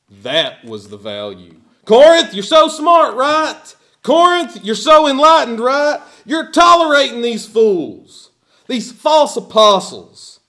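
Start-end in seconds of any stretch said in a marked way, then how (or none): 1.8-10.4 high, loud mocking cry ending in a shout; preacher mimicking the fools, shouting sarcasm at Corinth; sarcastic. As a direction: jeer at them in their own boastful tone. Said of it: "talking like the fools".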